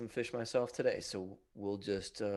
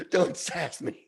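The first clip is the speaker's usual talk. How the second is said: mock scold; 6 semitones higher, 7 dB louder, slower than usual. Old lady scolding laughing.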